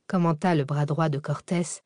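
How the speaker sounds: ordinary delivery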